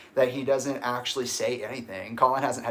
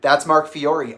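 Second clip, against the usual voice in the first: raised, louder voice making a claim; indignant explaining; 3 semitones higher, 8 dB louder than usual. Retold or imitated. Explaining to a kid who stopped, loud and insistent. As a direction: insist loudly on who you are. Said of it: "And I was like".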